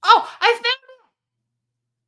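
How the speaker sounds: high, loud, drawn-out relief; long, high, triumphant oh; excited discovery. Real, not acted. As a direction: cry out that you've found it. Finds the lost file at last.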